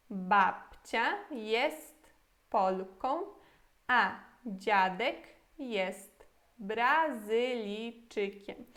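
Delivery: slow, every Polish word spelled out for learners; slow clear model sentence. Shown as a demonstration. Teacher modelling the full Polish sentence for students, slow and clear. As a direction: read the whole sentence slowly like a teacher. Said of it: "E a frase toda em polonês?".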